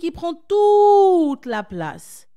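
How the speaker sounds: étire chaque mot, plus haut et plus fort; dramatic emphasis. Shown as a demonstration. Illustre le limbique. (lent, plus aigu et plus fort)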